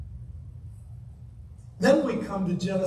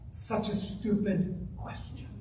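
hushed aside; 2 semitones lower, 10 dB quieter than usual. Acted. Preacher mocks serpent's question.

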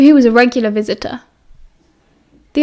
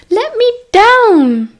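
whiny plea; 11 semitones higher, 4 dB louder, much slower than usual. Acted. Sirius pleading again, drawn out.

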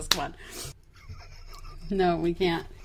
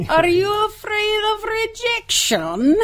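put-on higher, a Caribbean psychic-hotline accent in a Miss Cleo style; Miss Cleo impression; 10 semitones higher than usual. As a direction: play the late-night TV psychic with a Caribbean lilt, pitched higher. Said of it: "Now it sounds like Miss Cleo".

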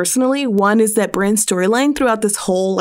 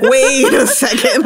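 laughing mock double take; 4 semitones higher, 4 dB louder than usual. Mocking audience reaction.

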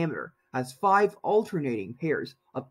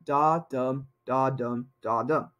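da-DUM da-DUM da-DUM, stress on each dum; heartbeat rhythm; 3 semitones lower, slower than usual. Shown as a demonstration. Teacher showing iamb rhythm, da-DUM stressed. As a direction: tap out the heartbeat, weak then strong. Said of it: "Iambs have the same rhythm as a heartbeat".

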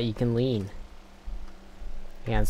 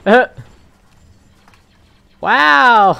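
loud shocked wow; surprised shout; 12 semitones higher, 13 dB louder than usual. Real, not acted. Sudden death in game.

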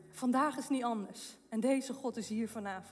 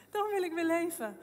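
joyful amazement; 4 semitones higher than usual. Retold or imitated. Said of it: "dacht ik".

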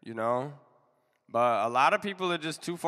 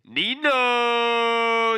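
hype call; 9 semitones higher, 7 dB louder than usual. Acted. Hype-announcing the next artist.

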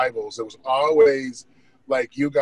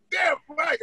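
yelling from the stands. Retold or imitated. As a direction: shout to get a friend's attention. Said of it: "I'm yelling everything".

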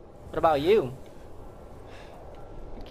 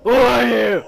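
loud demand; 4 semitones higher, 6 dB louder than usual. Real, not acted. Startled by a stranger in game.

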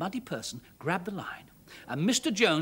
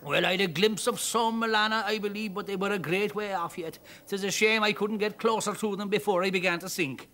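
Irish man; 3 semitones higher than usual. Acted. Rescued Irishman thanking father and daughter, grateful brogue. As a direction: thank them in a thick Irish lilt.

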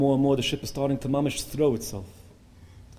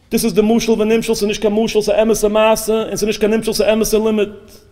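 raised, loud sermon voice; impassioned preaching; 9 semitones higher, 9 dB louder than usual. Real, not acted. Preacher tells congregation the lesson of suffering, impassioned preaching. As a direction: preach it with full force, voice pitched up.

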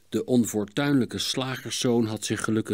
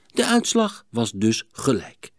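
ordinary delivery

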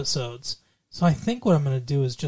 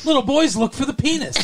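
mocking, laughing; 9 semitones higher than usual. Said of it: "You can't just be like".

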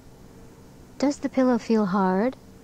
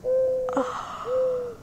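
big airy sighing yawn; demonstrated yawn; 4 dB quieter than usual. Shown as a demonstration. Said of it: "And yawn".

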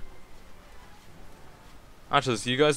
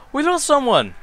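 dismayed cry; 7 dB louder than usual. Real, not acted. Unit dies.

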